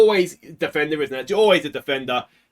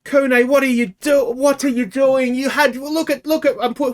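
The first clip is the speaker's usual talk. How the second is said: high, pleading what are you doing; exasperated; 7 semitones higher than usual. Real, not acted. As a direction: scold the player through the screen. Scolding player after the mistake.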